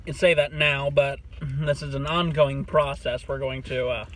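eating a sandwich mid-sentence; chewing while talking. Real, not acted. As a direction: mouth partly full of bread. Seen 2: right speaker holds bitten sandwich, bites before and after, mouth working.

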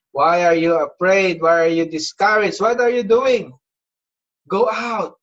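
loud, rising preacher's challenge; urgent exhortation. Acted. Preacher voicing God's urgent challenge to Elijah.

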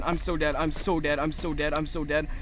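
low, quiet repeated muttering; resigned dread. Real, not acted. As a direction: mutter it low under your breath. Panicked muttering as game threatens.

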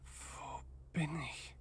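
slow, soft whisper. Acted. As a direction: whisper it lost in the dark. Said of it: "Picard flüstert". (langsam geflüstert)

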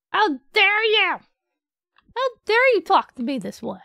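high, loud indignant squawk; mock outrage. Player pretending to be offended, high loud mock outrage.

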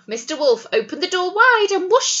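ordinary delivery, nothing marked

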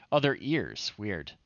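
ordinary talk

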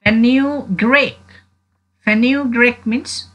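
fenugreek said slowly and clearly; teacher modelling fenugreek slowly; careful pronunciation. Shown as a demonstration. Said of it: "repeated model word, slowed".

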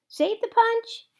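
high, slow, loud cry; alarmed yell. A narrator's reading. Someone yells to save the punch, loud and high. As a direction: call out as the rain hits.

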